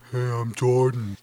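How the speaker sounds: soft, low mock hipster; lazy, too-cool hipster intro; hipster Jordan. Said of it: "they're gonna come in and be like".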